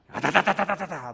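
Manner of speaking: loud, slow rattling engine imitation; imitated motorbike noise. Pastor recalls wanting a motorbike, mimicked engine rattle.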